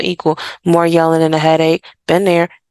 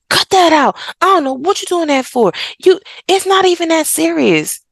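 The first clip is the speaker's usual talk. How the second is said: higher, mock-irritated parent scolding; dismissive parent; 9 semitones higher than usual. Parent snapping at an upset child, mock dismissive. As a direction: brush off the child's feelings, impatient. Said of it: "And if you're like".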